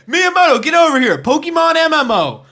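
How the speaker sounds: loud, summoning call. Pretend. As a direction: yell for him to come over. Calling out to Miyamoto.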